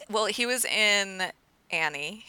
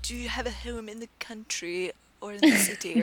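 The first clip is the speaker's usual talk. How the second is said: put-on posh British accent; 5 semitones higher than usual. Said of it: "talking in, like, an extremely posh British accent".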